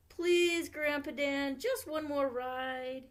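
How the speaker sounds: put-on high, slow pleading little girl. A narrator's reading. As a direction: beg sweetly like a child. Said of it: "pleaded Nikki".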